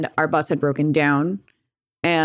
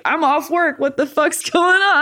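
exasperated dad, half laughing; 10 semitones higher than usual. Retold or imitated. Dad, just off work, exasperated.

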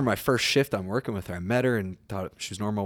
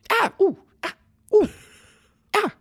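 sudden high-pitched little yelps; short high noises with gaps, like hiccups; imitated verbal tics; 4 dB louder than usual. Imitating a coworker's tics at her desk, high little yelps. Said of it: "just be like".

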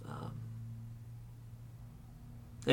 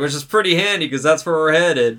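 loud, high, chuckling through it; laughing drunk quip; 6 semitones higher, 7 dB louder than usual. Retold or imitated. Drunk passenger joking back to the nervous one, laughing. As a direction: grin through the joke. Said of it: "And he's like".

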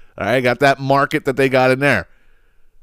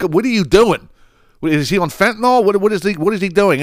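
alarmed disbelief; 8 semitones higher than usual. Retold or imitated. Frantic guy, imitating own alarm.

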